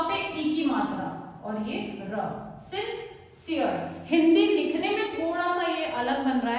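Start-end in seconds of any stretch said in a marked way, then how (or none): 2.7-4.0 सिंसियर drawn out very slowly, syllable by syllable; slow clear demo. Shown as a demonstration. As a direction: stretch सिंसियर slowly so each sound is clear. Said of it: "इसका pronunciation रहेगा सिंसियर".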